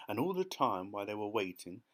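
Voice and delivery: usual speaking voice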